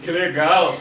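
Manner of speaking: a touch of excited awe, with a laugh; recalling meeting the band, lightly excited with a laugh. Retold or imitated. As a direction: retell your starstruck inner voice.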